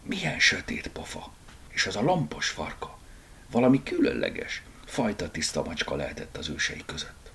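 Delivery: soft, half-whispered musing; hushed, private observation; muttering to self. Acted. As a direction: murmur under your breath. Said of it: "motyogta maga elé Borneo".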